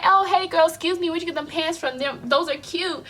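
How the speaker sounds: put-on high-pitched, quick girly chat voice; friendly compliment. Showing how a stranger should ask about pants, chatty. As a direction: imitate a chatty girl complimenting someone.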